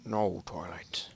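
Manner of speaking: ominous whisper. Acted. Ominous reveal.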